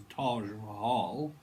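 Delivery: slow, drawn-out posh vowels; mock very posh English; posh accent. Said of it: "someone that's very posh".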